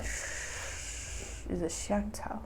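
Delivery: soft unsure whisper. Real, not acted. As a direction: whisper to yourself. Flüstert beim Zutatenraten.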